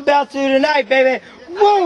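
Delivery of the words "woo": excited whoop. Real, not acted. Commentator hyping up the night, excited whoop. Commentator cheering during the match.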